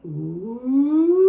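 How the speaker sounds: a loud ooh climbing up; voice rising loud. Shown as a demonstration. Teacher leads kids tracing the pumpkin's climb, loud rising ooh. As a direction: trace the pumpkin rolling up the hill. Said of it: "let's use our voices to trace the path".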